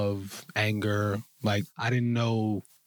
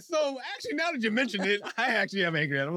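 pitched up high and rushed with a laugh; amused realization; 9 semitones higher, faster than usual. Retold or imitated. Admitting to the therapist he is angry, high, fast and laughing. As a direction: give in gleefully, tumbling out fast.

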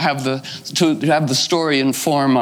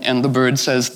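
plain reading voice

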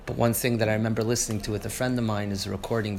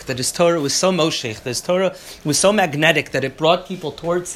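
louder, higher, driving lecture voice; fired-up preaching; 6 semitones higher, 6 dB louder than usual. Acted. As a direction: preach the rebbe's greatness with rising fervor. Fired-up Torah class.

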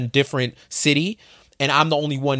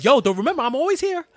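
much higher, sweet, pleading would-be lover voice; eager headhunter. Retold or imitated. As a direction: woo him like an ex waiting in the wings. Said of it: "they're like the person that's waiting for you to slip up".

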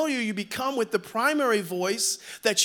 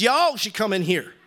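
usual speaking voice

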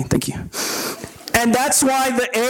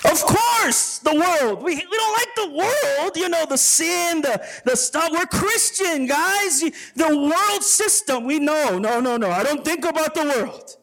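high, prim churchy voice; 7 semitones higher than usual. Pretend. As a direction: play the smug believer.

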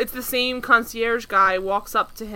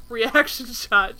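ordinary talk

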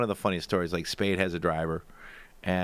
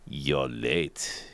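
German driver; 8 semitones lower than usual. Retold or imitated. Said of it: "He'd go".

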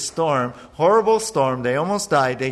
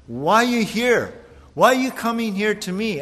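higher, indignant demon voice; lightly voiced indignant demon; demon's protest; 6 semitones higher than usual. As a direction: make the demon demand why. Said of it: "In other words".

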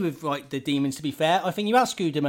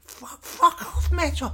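much higher, strained, slow yell; mock shout; 14 semitones higher, slower than usual. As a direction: shriek it back at the archangel. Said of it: "you weren't shouting, were you?".

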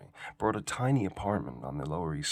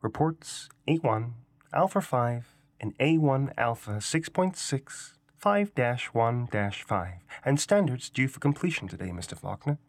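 bright, chirpy computer voice, higher; perky robot voice; 4 semitones higher than usual. A narrator's reading. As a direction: read it like a cheerful terminal. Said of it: "The perky robot voice of his terminal piped up".

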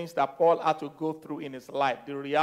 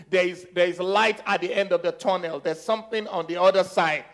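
raised, insistent preaching voice; fervent preaching; 3 semitones higher, 4 dB louder than usual. Real, not acted. Preacher urging people through hell to the other side, fervent. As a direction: drive it home to the congregation with force.

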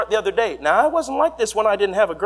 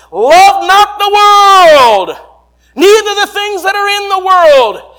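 thundering scripture reading; 8 semitones higher, 8 dB louder than usual. Real, not acted. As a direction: declaim the verse like a revival preacher. Preacher recites scripture at full force.

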